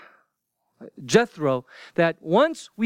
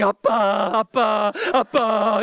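tearful, whining cries of Appa; mock crying kids; slower than usual. Moses's kids running to their father in a K-drama, mock crying. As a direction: imitate sobbing children calling Appa. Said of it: "the two kids going".

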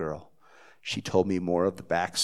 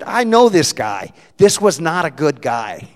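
shouted, high New Jersey declaration; booming Jersey accent, "This" hit hard; loud Jersey accent; 8 semitones higher, 14 dB louder than usual. Retold or imitated. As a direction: declare it loudly like a blunt Jersey woman. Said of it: "in her broken Jersey accent".

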